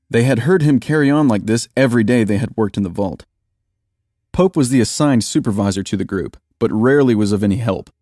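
ordinary delivery

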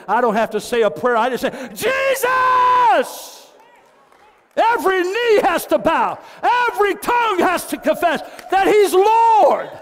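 high, loud shouted preaching; ringing preacher's shout to the church; full-force preaching. Acted. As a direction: shout the name like a battle cry.

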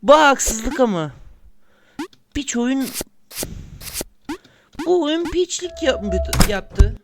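high, loud, indignant complaints; angry outburst. Real, not acted. Gamer yelling at the unfair game, high furious outburst. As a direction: rage at the game for cheating you.

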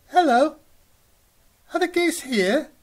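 put-on high, loud baby-goose voice, asking eagerly; high little gosling. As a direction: call out like a tiny lost gosling. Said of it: "And Goz says".

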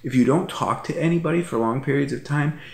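ordinary talk, nothing marked

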